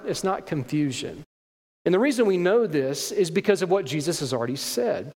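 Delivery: ordinary talk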